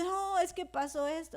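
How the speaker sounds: usual speaking voice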